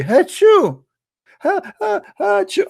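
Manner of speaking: a loud, high, staged sneeze with a giggle; fake sneeze. Shown as a demonstration. Host inviting chat room to sneeze, mock sneeze. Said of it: "Let us sneeze so Allah get happy".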